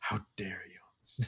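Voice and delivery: breathy whispered how dare you, slowly. Pretend. Whispering the meme quote back to his friend, slowly. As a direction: hush it like a secret, slow. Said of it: "whispered echo of quote".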